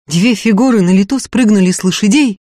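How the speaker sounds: ordinary delivery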